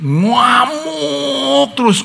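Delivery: high, slow shouted drawl; drawn-out shout. Retold or imitated. Preacher acts out the raging uncle, drawn-out high shout. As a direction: stretch it out in a high yell.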